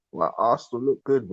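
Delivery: ordinary delivery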